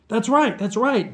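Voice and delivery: high, loud, eager agreement; excited. A narrator's reading. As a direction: burst out with it, pitch way up. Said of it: "Vlad said excitedly".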